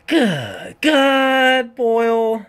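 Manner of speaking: high, loud awed cry; loud, high awe; stunned exclamation. Real, not acted. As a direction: blurt it out floored. Stunned by the verse.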